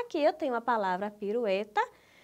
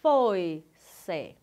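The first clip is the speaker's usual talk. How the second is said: "Foi-se" in two slow beats, "Foi" whole and louder, low; syllable division; 4 semitones lower, 5 dB louder than usual. Shown as a demonstration. Teacher showing an unbroken diphthong, syllable division. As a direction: split "Foi-se" only after the diphthong. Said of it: "Não se separa os ditongos".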